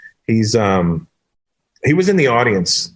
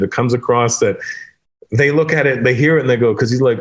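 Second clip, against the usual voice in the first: ordinary talk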